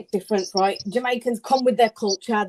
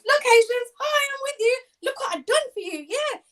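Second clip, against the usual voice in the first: much higher, fake-friendly mock voice; mocking politician; 12 semitones higher than usual. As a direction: parody a politician pandering to voters. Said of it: "equivalent to Rishi Sunak doing his little Diwali thing".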